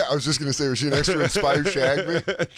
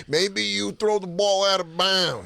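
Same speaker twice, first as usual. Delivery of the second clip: Chris Italian. Acted. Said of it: "It's also my Chris Italian impression".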